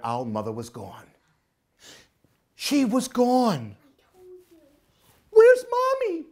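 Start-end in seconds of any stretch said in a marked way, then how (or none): 5.3-6.2 put-on high, louder anxious child voice; big sister owl calling for Mommy; worried big sister owl. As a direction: ask for Mommy in a fretful high voice. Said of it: "said Sarah".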